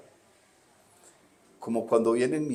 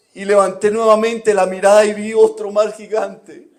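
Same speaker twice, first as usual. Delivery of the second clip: loud, high, impassioned sermon shout; full-force preaching; 19 dB louder than usual. Acted. Pastor proclaims God's words to the congregation, full-force preaching shout. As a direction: preach it at the top of your lungs.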